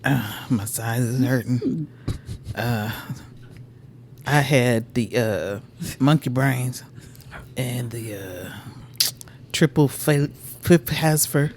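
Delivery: sluggish, stuffed and slurry. Real, not acted. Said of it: "Why do you sound like someone's drunk uncle?".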